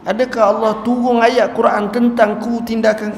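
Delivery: high, loud fearful question; the weeping man fearfully asks Umar if he is cursed; anxious plea. Acted. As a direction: ask in dread of being cursed. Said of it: "Lalu dia kata".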